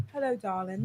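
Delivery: lightly put-on British charmer, hushed and low; British accent. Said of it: "like, in a British accent".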